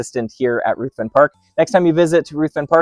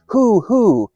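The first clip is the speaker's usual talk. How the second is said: a lightly higher, louder hoo hoo like an owl; owl hoot; 7 semitones higher, 4 dB louder than usual. Shown as a demonstration. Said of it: "I might call out".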